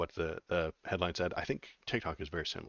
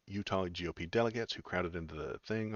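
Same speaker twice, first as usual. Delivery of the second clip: normal talking voice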